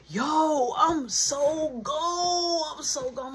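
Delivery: slow, high, stretched mock stoner voice; imitating Marky. Marky stumbling out of the bathroom, lightly voiced stoned drawl. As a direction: drag it out like Marky totally gone. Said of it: "He like".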